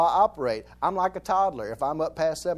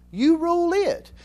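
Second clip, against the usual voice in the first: slow, higher and louder punch; a slow emphatic declaration; 10 semitones higher, 4 dB louder, slower than usual. Real, not acted. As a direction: press it slowly home. Preaching emphasis.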